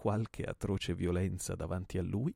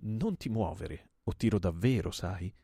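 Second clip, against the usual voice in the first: terrible command; 6 dB louder than usual. Acted. Gunman to his cowering target, fierce warning. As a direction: order him to stay still, meaning it. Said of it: "intimando terribile".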